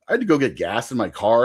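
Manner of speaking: normal talking voice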